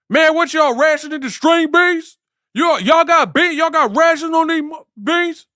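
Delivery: very high, loud whining about beans. Retold or imitated. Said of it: "Same dialect, same accent".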